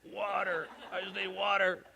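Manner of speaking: high, soft, faint plea from a wilting plant; droopy sunflowers begging. Pretend. Pastor voicing his wilting sunflowers, a soft high plea. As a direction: whimper for water like a thirsty flower. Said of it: "I heard them say".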